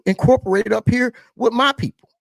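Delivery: ordinary talk, nothing marked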